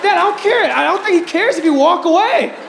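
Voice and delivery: pleading son. Retold or imitated. Son pleading with dad at the hot dog stand. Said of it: "I'm like".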